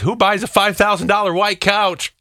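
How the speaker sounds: mock incredulous. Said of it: "somebody says".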